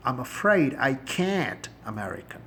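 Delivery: can't with the flat American a, slow; American accent. Shown as a demonstration. Teacher contrasting American can't with British, slowly. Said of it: "can't بولیں گے تو آپ American".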